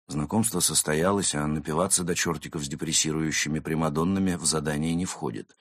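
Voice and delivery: ordinary delivery, nothing marked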